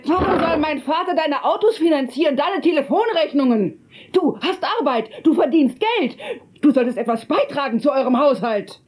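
high shrill accusing voice; angry. Acted. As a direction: rail at her, pitched high. Quarrel about money.

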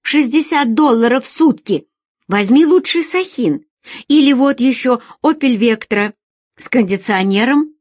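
loud, high, insistent listing; irritated sales pitch. Acted. Feride fires car offers at impatient Alex, irritated.

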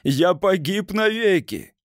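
high, slow anguished cry; shrieking despair. A narrator's reading. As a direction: wail it out in terror. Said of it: "визжал он".

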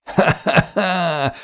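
drawn-out mocking ha-ha laugh; peals of laughter. A narrator's reading. Older man scoffing at redhead's ideals, peals of laughter. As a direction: burst into acted derisive laughter. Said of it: "залился смехом Грок".

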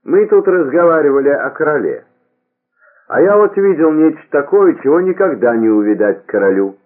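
high, loud theatrical peasant chant; singsong peasant impression. A narrator's reading. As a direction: declaim like an actor playing a peasant. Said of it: "произнёс он нараспев, подражая актёру". (громко и высоко нараспев, под крестьянина)

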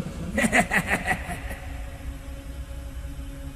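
breathy, high, far-off ghostly cackle; a hollow laugh from the dark; eerie ghost laugh. Acted. (tiếng cười ma quái, hơi thở)

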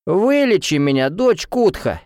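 high, jeering mock plea; mocking merriment. A narrator's reading. Drunk student jeers at heroine, mocking. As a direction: make fun with a put-on appeal. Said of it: "Недолго веселился воздушник".

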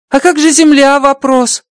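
high, bright-pitched alien voice, amazed; Rocky's alien voice. Acted. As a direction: play the little alien engineer, delighted. Rocky asks about Earth.

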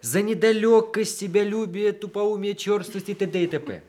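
high, fast teasing list of insults; mock contempt. He teases her by mocking himself, high playful scorn.

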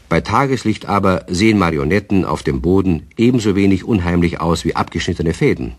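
ordinary talk

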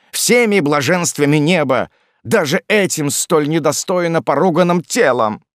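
high, loud, impassioned oath; desperate protest. A narrator's reading. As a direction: swear innocence with mounting fervour. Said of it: "его отчаяние, его протесты звучали так правдиво". (отчаянные клятвы высоким голосом)

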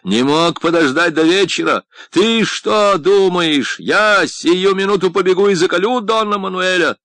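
building to a high, loud yell; angry shouting. A narrator's reading. Painter berates friend for tearing him from work, shouting. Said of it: "И тут же сам перешёл на крик".

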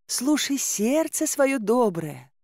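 high, slow, coaxing plea; pleading maiden. A narrator's reading. Girl appeals to his kind heart, pleading. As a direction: coax sweetly in a high, lingering voice. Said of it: "взмолилась девушка".

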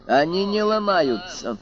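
indignant. Acted. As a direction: bristle with wounded pride. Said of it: "вознегодовал техник".

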